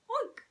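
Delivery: very high, squeaky little oink; a tiny creature's oink; frog oink. Acted. Frog pops up and oinks at the man.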